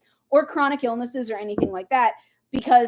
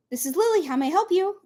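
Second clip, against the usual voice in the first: higher-pitched polite business greeting; phone greeting; 6 semitones higher, faster than usual. Shown as a demonstration. Midwesterner answering her business phone, polite greeting. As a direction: answer the phone in a lilting work voice. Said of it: "I say".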